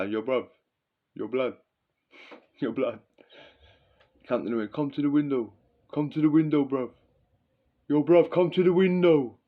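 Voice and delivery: put-on a light London roadman accent calling up to the window; lightly voiced young inmate in London street slang; imitating prison roadman accent. Young inmate calling a new kid to the window, light London street accent. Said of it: "Everyone talks with that accent".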